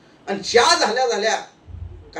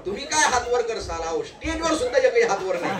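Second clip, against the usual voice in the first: normal talking voice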